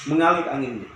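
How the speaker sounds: normal talking voice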